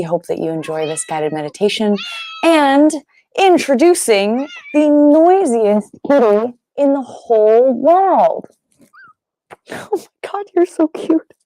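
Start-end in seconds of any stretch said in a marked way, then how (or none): 2.4-8.5 playfully grand, higher and louder announcer; big mock fanfare, stretching the final words; mock announcer introduction. Mock grand introduction of noisy cat. 9.7-11.3 high-pitched gushing over a cute kitty; real, squeaky pet-talk; cooing at pet. Real, not acted. Cooing at her cat.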